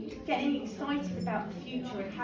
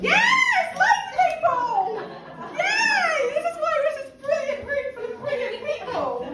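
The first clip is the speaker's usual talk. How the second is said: high-pitched celebratory cheer; excited cheering; 14 semitones higher, 5 dB louder than usual. Real, not acted. Host celebrates a room full of brilliant people, excited cheering.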